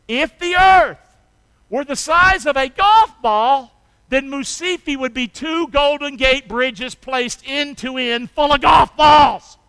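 loud, high, building to a shout; full-force preaching. Acted. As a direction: build to a big reveal.

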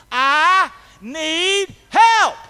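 slow, high, loud cry to God. Retold or imitated. Preacher has congregation echo his cry to the Lord, crying out. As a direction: cry out each word, long and loud. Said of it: "I cried out to the Lord".

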